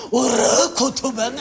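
slow, pleased with himself; proud pupil. Acted. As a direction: drag it out proudly. 弟子が得意がる. (得意げな弟子)

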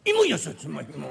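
slow, loud, stiff vendor call; hard sweet potato cry. Retold or imitated. Street vendor selling raw sweet potatoes, loud stiff cry. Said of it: "硬そうに売ったもんです".

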